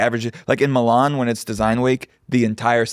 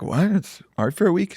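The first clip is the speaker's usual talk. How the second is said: mock surprise; 8 semitones higher than usual. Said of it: "average New Yorkers are like".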